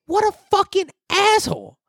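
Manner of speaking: high, put-on outraged voice; exasperated retort. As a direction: toss it off in a squeaky mock outrage. Agreeing with the other family.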